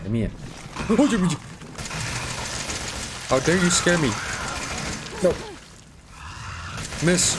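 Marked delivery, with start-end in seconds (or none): 0.4-3.2 sharp high gasp of fright; startled gasp. Real, not acted. Jump scare. 3.3-7.3 mock outrage. Real, not acted. Scolds monster after jump scare.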